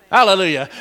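normal talking voice

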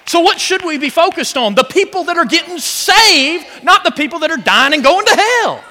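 loud, high, fervent preaching; preaching at full force. Real, not acted. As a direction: shout the good news to the church. Preacher climaxing the point.

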